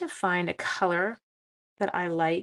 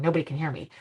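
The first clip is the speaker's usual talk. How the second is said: ordinary talk, nothing marked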